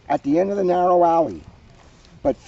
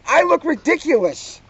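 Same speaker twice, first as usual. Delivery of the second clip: high, squeaky protest; 11 semitones higher than usual. A narrator's reading. Mona protesting her fairy princess costume, high whine.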